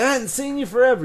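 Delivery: high, loud friendly hail; eager greeting. Retold or imitated. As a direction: call out to an old friend across the room. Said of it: "walk up to somebody and go".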